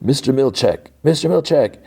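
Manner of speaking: high imitated holler for the boss; calling out. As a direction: call as if to people far off. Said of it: "Irving calling for Mister Milchek".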